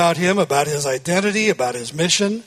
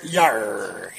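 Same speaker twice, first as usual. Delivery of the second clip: pirate growl; 8 semitones lower, 3 dB quieter than usual. Pretend. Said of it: "talk like a pirate".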